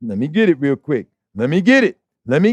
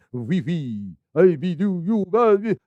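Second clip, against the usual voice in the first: sneering fake French accent, mumbled; mock French accent; slower than usual. Preacher mocking the French, mock French accent. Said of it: "pissy Frenchman".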